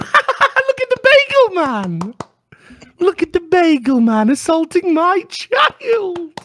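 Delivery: slow, very high falsetto shriek; mock parents. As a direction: jeer in drawn-out squealing delight. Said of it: "And the parents will be going".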